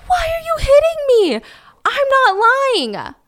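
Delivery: very high, wailing plea; distressed protest. Retold or imitated. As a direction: act out a tearful, hurt suspect protesting. Acting suspect slapped and protesting.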